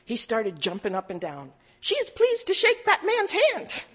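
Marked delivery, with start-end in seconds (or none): 1.8-3.8 squeaky high witch puppet voice. Retold or imitated. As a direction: pitch way up for the puppet.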